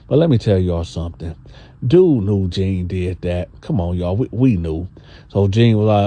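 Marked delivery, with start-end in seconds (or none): none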